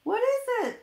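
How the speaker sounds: high, loud startled question; sudden high surprised ask. Acted. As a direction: ask it wide-eyed and amazed. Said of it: "asked Santa".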